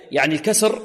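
normal talking voice

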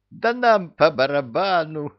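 high, loud carefree shout; reckless. A narrator's reading. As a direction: toss it off recklessly. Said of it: "бесшабашно заявил Люка".

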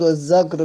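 والزقر with a buzzed z; والزقر with z replacing the s sound; word with zay. Shown as a demonstration. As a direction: say والزقر as "waz-zaqr". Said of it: "بالزاي".

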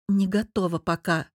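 usual speaking voice

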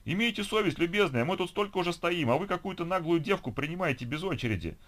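high-pitched aggrieved protest; indignant. A narrator's reading. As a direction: appeal to an official's conscience, outraged. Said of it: "слегка понизив тон, возмутился мужчина".

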